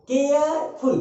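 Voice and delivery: Careful as care, pause, फुल; two separate syllables. Shown as a demonstration. Teacher shows two syllables, careful broken in half. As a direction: split careful into two beats. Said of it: "care और फुल".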